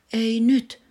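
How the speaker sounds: a sharp, loud, high order to himself; commanding. Acted. Sleepy boy commanding himself to stay awake. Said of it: "Olli komensi itseään".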